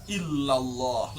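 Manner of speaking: long, slow, relieved "illallah"; lega. Shown as a demonstration. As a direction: let "illallah" out slowly like a release. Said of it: "Bilang illallahnya tuh lega". ("illallah" diucapkan panjang dengan lega)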